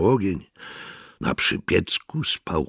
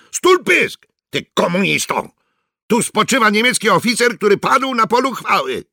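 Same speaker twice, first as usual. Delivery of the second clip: higher, louder furious snap; angry rebuke; 5 dB louder than usual. Acted. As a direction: shut him up with contempt. Sergeant berating Immermann.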